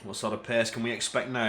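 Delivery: ordinary talk, nothing marked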